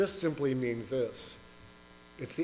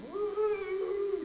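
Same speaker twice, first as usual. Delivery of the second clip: cow's call; 5 dB louder than usual. Retold or imitated. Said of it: "but she's".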